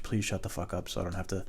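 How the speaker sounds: low-volume exasperated mutter; muttered plea. Retold or imitated. Man indoors wishing the neighbor would stop, muttered. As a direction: plead quietly to yourself.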